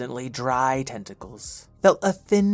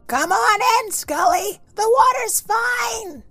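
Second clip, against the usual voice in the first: calling out. Acted. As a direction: shout it gleefully from the water. Wet kids call from pool.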